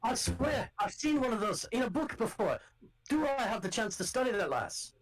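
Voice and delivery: gruff Scottish guard calling out to strangers; rough Scottish accent. Acted. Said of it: "I know somebody who talks like this".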